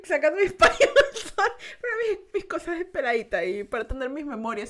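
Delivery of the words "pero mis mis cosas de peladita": ordinary talk, nothing marked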